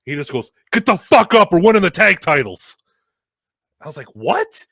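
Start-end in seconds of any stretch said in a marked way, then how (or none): 0.7-2.8 loud, high urgent shout; retold barked order. Colleague in his face, shouted order. 4.1-4.6 high, loud startled question; startled disbelief. Retold or imitated. Wrestler hearing surprise title win, startled. His startled reply to order.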